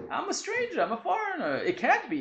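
voicing Ruth. Voicing Ruth's self-deprecating plea.

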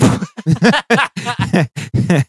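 high-pitched burst of real laughter; laughing. Real, not acted. Laughs at own anger jab.

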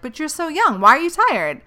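mocking people who know about her illness, high and loud; mocking impression. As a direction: mimic them scornfully. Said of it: "still say weird shit like".

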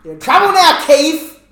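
jokey shout at a celebrity; mock threat. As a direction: yell it like a playful threat.

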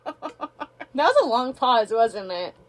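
slow, laughing stoned drawl; spaced-out and giggly; stoner. Real, not acted. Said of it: "I'm really high right now".

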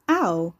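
short, loud "ow"; pained yelp. A narrator's reading. Carrie tugged to the wall, a pained yelp. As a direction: yelp briefly at a sudden hurt. Pulled roughly, hurts her arm.

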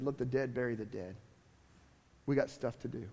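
a lightly voiced, hushed Jesus. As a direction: drop low and quiet for Jesus's answer. Quoting Jesus, lower, hushed.

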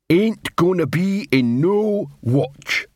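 slow growling troll voice. A narrator's reading. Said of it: "growled Coalface".

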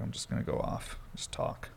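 soft breathy whisper; whispered quote. Retold or imitated. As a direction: whisper it to yourself. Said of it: "So I was like".

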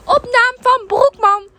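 high, loud repeating customer; old lady voice. Retold or imitated. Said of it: "Ze bleef het maar de hele tijd herhalen".

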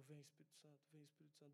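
soft, earnest calling on the Spirit; quiet prayer. Retold or imitated. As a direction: murmur the prayer under your breath. Praying over the screaming boy.

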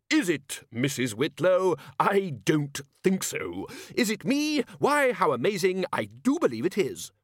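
high, mock-amazed and sarcastic. A narrator's reading. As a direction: play the mock surprise broadly. Ridcully mocking rhetorical questions.

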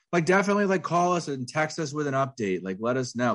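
high, overdone show of concern; put-on caring, pitched well up; pretend concern. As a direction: sound eagerly concerned without meaning it. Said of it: "pretend like you're concerned".

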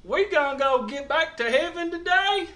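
gee-whiz hillbilly voice; Gomer Pyle angel. Retold or imitated. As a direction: play a goofy country bumpkin angel. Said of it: "they send Gomer Pyle angel down there".

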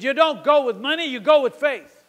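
high, shouted preacher's rebuke; Lester Summerall. Retold or imitated. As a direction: drive it home at full force. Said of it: "it was first a rebuke".

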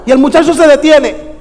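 normal talking voice

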